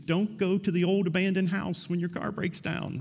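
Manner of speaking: high, pleading warning call; shouting at the screen. Pretend. Preacher shouting at a horror movie, high and pleading. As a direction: beg the horror movie victim to stop. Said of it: "you shout at the screen".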